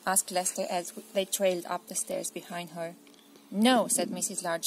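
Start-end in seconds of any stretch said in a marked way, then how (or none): 3.5-3.9 firm no. Acted. Mother refuses.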